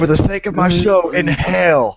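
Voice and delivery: high goofy voice; funny voice. Acted. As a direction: put on the silly cartoon voice. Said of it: "so you can do the funny voice".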